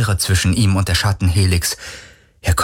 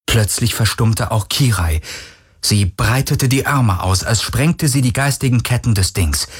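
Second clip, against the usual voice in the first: plain reading voice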